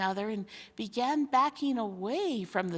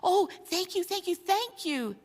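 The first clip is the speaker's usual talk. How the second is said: higher, bubbling gratitude from many; eager thanks; 10 semitones higher than usual. Acted. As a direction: gush thanks in relief. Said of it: "said dozens of voices".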